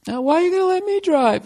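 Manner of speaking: put-on high, puzzled girlfriend voice. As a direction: play the confused girlfriend way up high. Girlfriend questioning in the car.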